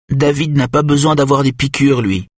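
put-on high little boy's voice; clear, high voice of a young son; clear, high-pitched child. A narrator's reading. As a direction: pitch it up like a small child piping up. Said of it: "sa voix claire et haut perché".

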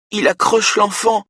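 very high, distressed whimper; moaning.

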